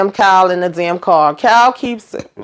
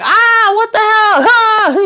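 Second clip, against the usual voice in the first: retold crash scream at top pitch; mock screaming; 14 semitones higher than usual. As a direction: wail it way up high, hammed-up. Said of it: "you're just hearing Kyle like".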